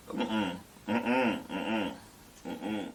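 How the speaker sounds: normal talking voice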